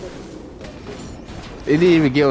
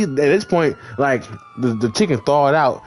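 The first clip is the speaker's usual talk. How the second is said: ordinary talk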